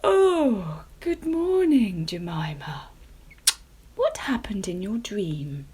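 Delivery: lightly voiced yawning mother, slow; a hint of just-woken mother; sleepy mamma. A narrator's reading. Mamma pumpkin waking to her daughter, lightly sleepy. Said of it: "Mamma Pumpkin yawned".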